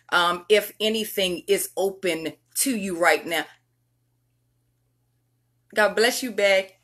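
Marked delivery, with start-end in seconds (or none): none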